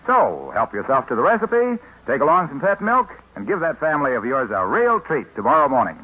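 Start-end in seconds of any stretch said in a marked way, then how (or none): none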